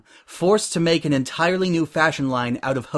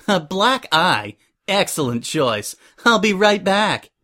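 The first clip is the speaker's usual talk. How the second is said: higher, perky and eager to please; cheerful; 6 semitones higher, 3 dB louder than usual. A narrator's reading. Suri takes Prim's coffee order, cheerfully. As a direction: chirp it cheerfully, drawing out the order. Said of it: "Suri said cheerfully".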